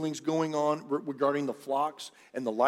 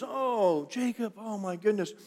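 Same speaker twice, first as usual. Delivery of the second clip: delighted greeting; 6 semitones higher, 3 dB quieter than usual. Retold or imitated. Laban greets his nephew Jacob.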